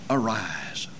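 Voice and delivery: low, very soft single word; God's soft command. Retold or imitated. God raising the dead with a hushed word. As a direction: breathe the command quietly. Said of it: "point the finger and say".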